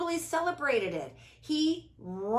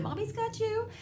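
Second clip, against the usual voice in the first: tender comfort; 10 semitones higher than usual. Retold or imitated. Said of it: "and I said".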